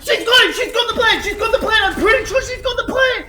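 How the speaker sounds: screaming play-by-play, voice cracking high; excited shouting. Real, not acted. Caster yelling as the player clutches the round. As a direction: lose it over the clutch, yelling.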